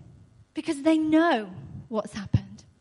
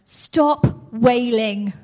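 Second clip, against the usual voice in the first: commanding quote; 2 semitones lower, 7 dB louder, much slower than usual. Retold or imitated. Jesus quoted commanding mourners.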